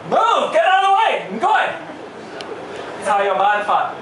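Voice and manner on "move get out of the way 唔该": mock shout. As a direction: shove through the crowd yelling. Mock rude pushing through crowd.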